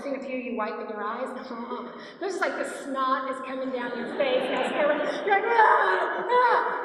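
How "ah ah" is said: high, loud mock sobbing cries; reenacting her sobs in the bathroom; imitated loud sobbing wail. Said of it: "and I was just sobbing".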